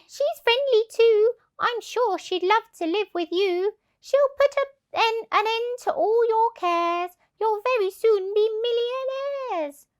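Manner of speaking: high, eager salesy voice; coaxing duck. Acted. Duck tempting the thieves, high and eager. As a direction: sweet-talk them eagerly. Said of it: "The duck joined in".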